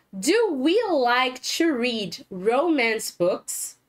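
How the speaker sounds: slow, careful classroom question; slow model question. Shown as a demonstration. Teacher modelling question for students, slow and clear. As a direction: read the question slowly for learners.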